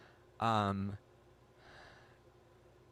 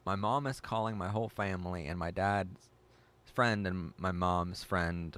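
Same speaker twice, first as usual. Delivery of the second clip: ordinary delivery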